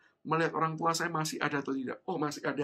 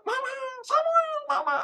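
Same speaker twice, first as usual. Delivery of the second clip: calling out; 7 dB louder than usual. Retold or imitated. Lost child and mother calling each other, high shouts. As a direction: shout across a crowd to be found. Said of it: "begitu mendengar suara itu, saya berkata".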